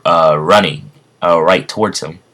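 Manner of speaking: ordinary delivery, nothing marked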